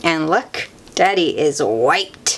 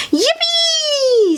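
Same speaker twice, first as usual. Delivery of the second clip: very high, loud cheer; joyful yippee; 3 dB louder than usual. Acted. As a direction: squeal it with delight. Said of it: "says Peppa".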